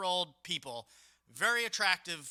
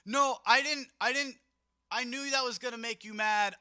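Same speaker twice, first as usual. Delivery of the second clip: flustered protest; 6 semitones higher, 8 dB louder than usual. Retold or imitated. Drunk guest protesting innocence.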